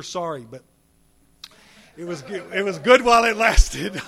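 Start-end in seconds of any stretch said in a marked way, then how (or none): 2.0-4.0 loud laugh through the words. Real, not acted. Speaker laughing to the crowd about the smoking days, loud and laughing.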